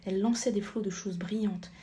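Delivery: ordinary delivery, nothing marked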